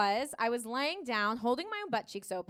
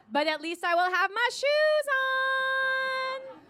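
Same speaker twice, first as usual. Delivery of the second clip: slow mock triumph; 10 semitones higher, 3 dB louder, much slower than usual. Slow high triumphant punchline.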